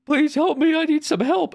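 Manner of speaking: high, loud mock child plea; hammed-up desperate child; kid pleading. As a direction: beg for help like a panicked kid. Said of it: "you go up to someone and you're like".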